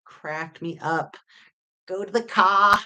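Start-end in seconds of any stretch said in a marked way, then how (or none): 1.9-2.8 mock Boston accent with dropped R. Teasing those silly Bostonians, Boston accent. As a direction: imitate a Bostonian, broad open "car". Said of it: "the Boston accent is the best".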